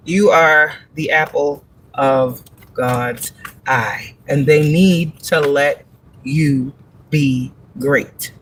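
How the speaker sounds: slow, low, solemn pronouncement of God's word; slow solemn message. Retold or imitated. Reader passing God's message to the viewer, slow and low. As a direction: deliver it slowly and low like a divine oracle. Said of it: "I'm just a messenger".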